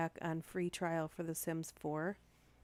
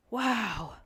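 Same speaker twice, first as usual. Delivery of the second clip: amazed; 5 semitones higher, 8 dB louder than usual. Real, not acted. Reacting to the fire story.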